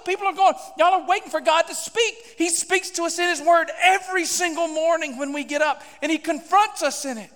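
normal talking voice

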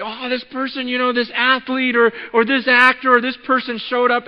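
high, loud, imitated gushing; mock-thrilled name-dropping at full volume; starstruck excitement. Churchgoer reporting a celebrity at service, excited.

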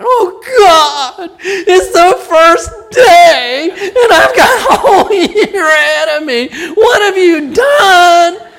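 loud, high wailing prayer, drawn out; mock-bawl in despair to God; comically exaggerated sobbing wail; hammed-up crying. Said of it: "I just start crying".

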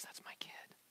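very soft whisper, full of affection; tender whisper. Acted. Heavenly Father looking down on his child, tender whisper.

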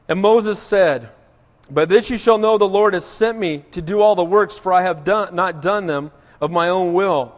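scripture reading. Acted. Pastor reading Moses' words to his church, solemn and loud. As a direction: read the verses out with weight.

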